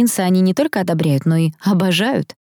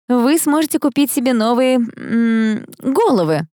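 higher-pitched sly dig with a hesitation; snide teasing; 7 semitones higher, slower than usual. A narrator's reading. Sister needling her brothers, snide. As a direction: tease them with mock sweetness. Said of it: "ехидно обратилась я к братьям".

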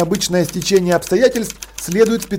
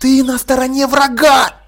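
hissed accusation, higher pitch; hissing; 6 semitones higher than usual. A narrator's reading. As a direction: spit the words at him. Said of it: "прошипела Полли".

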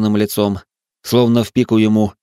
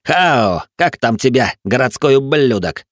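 hostile, higher-pitched thug; spitting contempt; 5 semitones higher, 3 dB louder than usual. Acted. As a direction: spit it out with hatred. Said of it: "сплюнул Хэнк". (презрительно, зло, на повышенном тоне)

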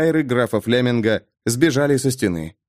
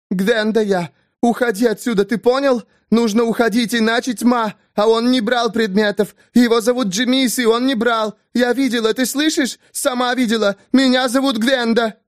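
shrill scream; 10 semitones higher than usual. Acted. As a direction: scream no like a madwoman. Gwenda keeps shouting at count.

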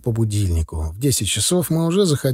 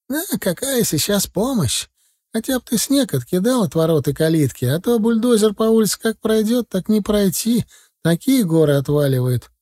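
put-on higher-pitched old woman, lightly complaining; elderly neighbour woman; 8 semitones higher than usual. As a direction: voice a busy old countrywoman, pitched up. (пожилая женщина, высоким голосом)

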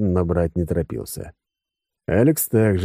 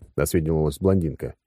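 ordinary delivery

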